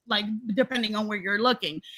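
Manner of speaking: ordinary delivery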